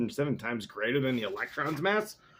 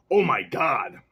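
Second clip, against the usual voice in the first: louder mock exclamation; alternate reading; 7 dB louder than usual. Guessing what they meant to say, loud mock exclamation. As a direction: call it out as a second try. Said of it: "Maybe they meant to say".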